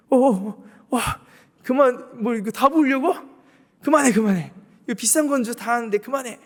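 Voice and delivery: hammed-up crowd panicking over wasted perfume; alarmed onlookers. Onlookers shouting at the woman to stop pouring.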